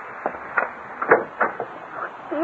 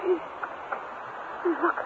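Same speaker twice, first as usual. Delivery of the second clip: whispering; 4 semitones higher, 16 dB quieter than usual. Acted. Urgent warning.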